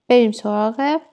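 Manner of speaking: usual speaking voice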